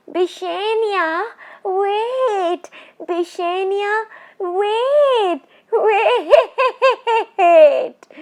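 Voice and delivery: high, drawn-out eerie calls ending in a laugh; a witch calling after her fleeing prey; ghostly calling. Acted. Ghost woman calling after the fleeing Bishan Singh, high and eerie. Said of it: "the words swooped into his ears".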